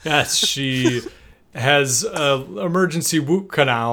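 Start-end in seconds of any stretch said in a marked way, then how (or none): 3.2-3.9 "woot canal" with a w replacing the r; dental-numbed mispronunciation. Retold or imitated. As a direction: mimic her speech defect on "woot canal". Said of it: "to put it in her own words".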